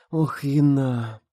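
slow, drawn-out complaining sigh; mournful, stretched whining; plaintive whine. A narrator's reading. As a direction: whimper her friend's name. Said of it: "жалобно заныла Рита".

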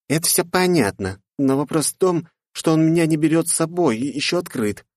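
put-on high, insistent young woman; won't let it go; restless girl voice. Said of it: "не могла успокоиться девушка". (настойчивая девушка)

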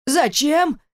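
высокий возмущённый крик; заори в ответ возмущённо. Acted. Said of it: "заорал Александр Демьяныч". (возмущённый крик отца)

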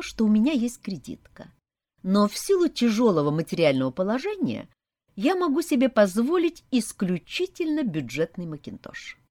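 ordinary delivery, nothing marked

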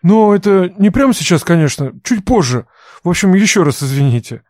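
hurried, embarrassed excuses; flustered young man. Acted. Embarrassed Gran apologizing.

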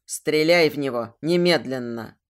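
low, forceful order; Amir. A narrator's reading. Villain ordering the heroine to shoot, low command. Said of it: "услышала в голове новый приказ".

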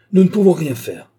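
high, loud cry; shouted admission. A narrator's reading. Stangate feigns surrender to the madman, shouting. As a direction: call it out to him. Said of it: "cria-t-il".

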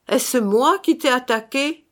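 high, rising challenge; insistent interrogation. A narrator's reading. Brother interrogates the dead man, insistent and high. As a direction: press the question like an accuser.

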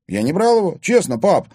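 high, loud, earnest protest; indignant. A narrator's reading. Son swearing to father he took nothing, indignant. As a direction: protest your innocence to father. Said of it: "возмущённо произнёс парень".

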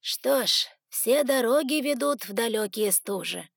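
ordinary delivery, nothing marked

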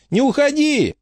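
high-pitched cry after someone leaving; pleading to stay. A narrator's reading. Frankie stopping Angelica at the exit, high pleading. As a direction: beg them to stay, way up high. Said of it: "попыталась остановить её Фрэнки".

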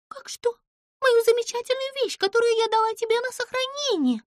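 high, loud indignant cry; exclaiming Pimpochka. A narrator's reading. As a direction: cry out in outrage. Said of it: "воскликнула Пимпочка". (высоко и возмущённо воскликнуть)

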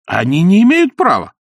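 loud high indignant cry; high protest. Acted. Изнуренков protesting to Ostap, high indignant cry.

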